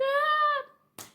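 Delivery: high pained yelp; a short high scream of pain; mock yelp. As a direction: cry out as if struck. Being hit by dad.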